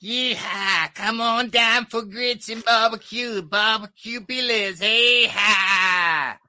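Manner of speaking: high, whooping Texan; Texan accent. Acted. Comic doing his club Texan bit, high whooping Texan accent. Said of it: "I do the Texans".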